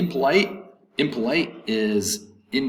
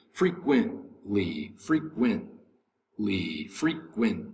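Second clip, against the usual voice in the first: "frequently" drawn out very slowly, lower and softer; syllable-by-syllable demo; 3 semitones lower, 5 dB quieter, much slower than usual. Shown as a demonstration. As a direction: sound out "frequently" slowly in separate parts. Teacher breaks word into syllables.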